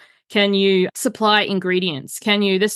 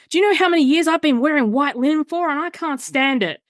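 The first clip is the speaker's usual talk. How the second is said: imitating mum; 6 semitones higher than usual. Mum admitting she hated the perfume, lightly imitated. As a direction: lightly voice the scolding mother. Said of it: "She's like".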